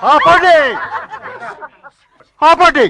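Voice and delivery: high, loud furious yell; explosive, high-pitched command; angry shout. Acted. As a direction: shout the revellers to a halt. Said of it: "బాబా అలా అరిచారెందుకు".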